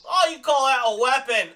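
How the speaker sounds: high, loud delighted cry; loud gushing delight; delighted exclaim. Real, not acted. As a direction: gush over it like it's adorable. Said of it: "Oh, that's so cute".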